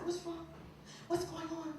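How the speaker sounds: soft, concerned questions. Retold or imitated. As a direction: gently ask what is wrong. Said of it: "I said".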